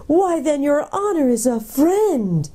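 high, drawn-out gnome delight; relieved. A narrator's reading. Gnome realises the prince is a friend, relieved. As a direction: let slow relief pour out, pitched high. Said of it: "gave a huge sigh of relief and added".

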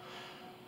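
tearful sigh. Real, not acted. Crying while confessing loneliness.

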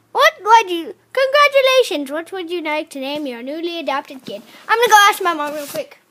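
ordinary delivery

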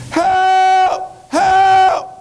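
high, loud, long-held cries; screaming for help. Acted. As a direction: holler for rescue at full pitch. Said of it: "so the man's going".

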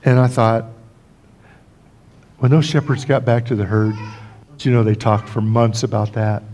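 ordinary talk

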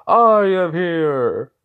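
loud, high, drawn-out hero entrance; heroic announcement. Acted. All Might arriving to save the day, booming and drawn out.

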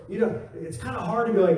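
ordinary talk

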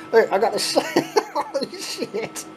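words collapse into real laughter; helpless laugh through a drawn-out curse; breaking up laughing. Real, not acted. Shaky hands, breaking up opening envelope.

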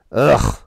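loud throaty noise of revulsion; disgusted ugh. A narrator's reading. Sparks pushes the toast away, disgusted. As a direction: push it out in disgust.

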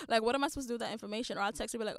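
ordinary talk

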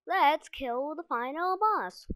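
slow, loud and rising; excited. Real, not acted. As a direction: drag it out, hyped up. Excited gamer at final boss.

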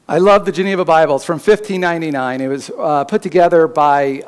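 usual speaking voice

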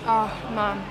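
ordinary talk

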